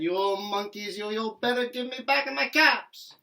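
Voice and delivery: loud, high angry demand; quite angry. Acted. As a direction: stamp and shout at the monkeys. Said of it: "Now he felt quite angry".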